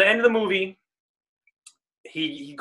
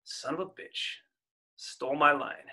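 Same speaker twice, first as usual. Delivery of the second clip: quiet quote; 7 dB quieter than usual. Retold or imitated. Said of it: "Ad-libbed, completely ad-libbed".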